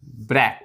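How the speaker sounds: ब्रेक said alone as the first half, low; first half of breakfast. Shown as a demonstration. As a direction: say just ब्रेक, a short clear syllable. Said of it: "half half करके". (आधा शब्द अलग करके, साफ़ उच्चारण)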